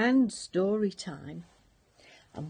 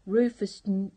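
ordinary delivery

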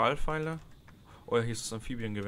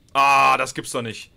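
loud, slow exasperated groan; loud, slow cry of disbelief; frustrated outburst; 3 semitones higher, 11 dB louder, slower than usual. Real, not acted. Just lost health after healing.

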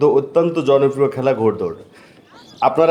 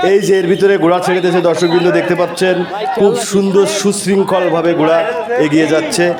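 race caller; 3 semitones higher than usual. Acted. Live race commentary.

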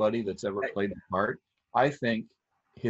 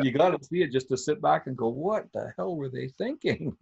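incredulous; 6 semitones higher than usual. Retold or imitated. Mocking the film's bad choices.

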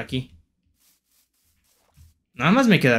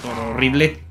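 ordinary delivery

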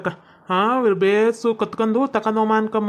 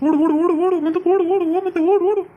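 higher, rhythmic cries to run; urgent urging; 5 semitones higher than usual. Acted. Hurrying the others away, urgent rising cries. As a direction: chant it urgently, driving everyone on.

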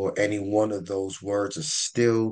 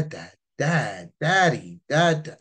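put-on higher, more emphatic child's calls; maturing child; 5 semitones higher, 4 dB louder than usual. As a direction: imitate an older child calling for father. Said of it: "as they mature, they start going".

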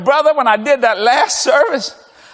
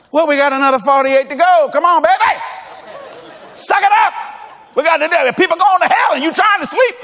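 mock drill sergeant; 6 semitones higher than usual. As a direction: bark him onward with a grin. Pastor driving tired Fritz on.

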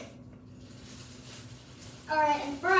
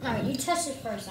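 normal talking voice

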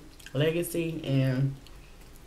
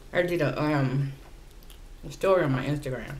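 chewing between words; talking while eating chicken; 4 dB quieter than usual. Real, not acted. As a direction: food in mouth speech. Seen 2: Handles wing throughout, bites at 4.4, mouth working while speaking.

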